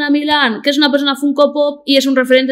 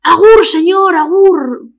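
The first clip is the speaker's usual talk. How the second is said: high squawky parrot voice, said louder; talking parrot; 6 semitones higher, 4 dB louder, much slower than usual. Retold or imitated. Parrot on the terrace greeting a man in the street, high squawk. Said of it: "y le diga".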